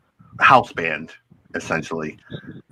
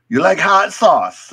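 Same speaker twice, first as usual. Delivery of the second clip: put-on Jamaican accent; 9 semitones higher, 3 dB louder, slower than usual. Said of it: "he's like".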